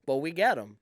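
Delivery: mock Maritime Canadian accent. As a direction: boast like a coastal Canadian. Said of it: "See, yours is kind of Irish".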